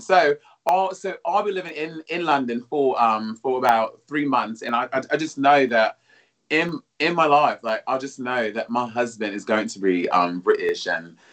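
hammed-up British accent for a co-host; mock British accent. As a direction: play a London posh Brit, half-joking. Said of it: "Give me your British accent now".

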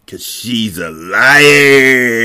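very slow, drawn-out jeer; mock accusation. Reader comments on why Alex lied, drawn-out mock accusation.

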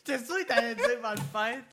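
en riant fort. Real, not acted. Éclate de rire en taquinant.

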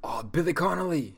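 high, loud delighted cry of a name; light up at a favourite actor; excited recognition. Real, not acted. Fan spotting his favourite actor, excited.

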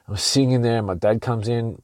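ordinary delivery

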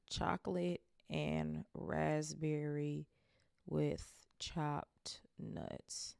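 very slow, each word drawn out; slow savoring. Shown as a demonstration. Reader slowly repeating the flavor to note it. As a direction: savor every word as you write it down. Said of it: "Literally just made a note".

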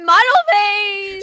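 loud, slow, hammed-up model face voice; mock model pose. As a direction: strike a pose and announce it loudly.

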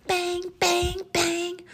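loud, high, even bangs; noisy toy sound effect; imitated toy banging. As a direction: chant it like a clanging toy.

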